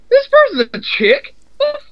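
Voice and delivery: high, stunned disbelief; shocked disbelief. Retold or imitated. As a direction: squeal it in total shock. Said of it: "You're like".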